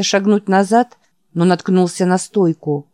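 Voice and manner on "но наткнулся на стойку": ordinary delivery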